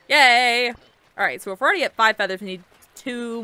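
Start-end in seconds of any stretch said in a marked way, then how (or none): 0.1-0.7 loud little victory cheer; excited cheer. Real, not acted. Cheering at game progress.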